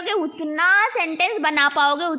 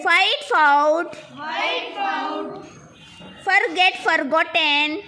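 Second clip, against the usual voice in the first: slow, drawn-out teacher chant; measured classroom verb recitation; repeat-after-me drill; slower than usual. Shown as a demonstration. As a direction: stretch each word for the class to echo. Leads word repetition drill.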